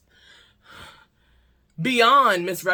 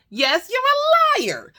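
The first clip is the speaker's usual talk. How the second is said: much higher and slow, drawn-out scolding; indignant accusation; 4 dB louder, slower than usual. Real, not acted. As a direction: stretch each word, scandalized. Indignant punchline at date.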